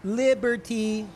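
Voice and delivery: mock the baby repeating it, slow; talking baby. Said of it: "And then the baby goes".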